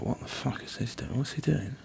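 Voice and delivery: near-whispered imitation of puzzled onlookers; hushed murmuring. Bus passengers puzzled by the singer, hushed. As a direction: drop right down to a baffled whisper. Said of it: "goes a bit quiet".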